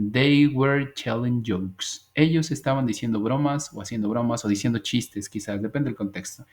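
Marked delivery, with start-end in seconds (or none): 0.1-2.1 spoken very slowly and clearly; slow model sentence. Shown as a demonstration. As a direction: lay out each word for learners. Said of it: "Y digo". (frase modelo muy lenta)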